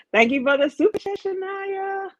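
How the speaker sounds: high, quick, bright-eyed thank-you; excited thanks. Real, not acted. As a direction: squeal the thanks at a donor. Excited thanks for super chat.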